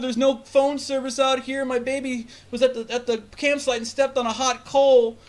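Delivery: high-pitched frantic plea, imitated; panicked mother. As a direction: play a stranded mom begging for help. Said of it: "a crying fucking baby ... And she's like".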